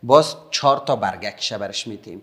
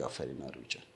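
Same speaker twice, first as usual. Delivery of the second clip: very soft, low-pitched encouragement; low, hushed well done; quiet praise; 10 semitones lower, 16 dB quieter, faster than usual. Real, not acted. Praising one child while drawing.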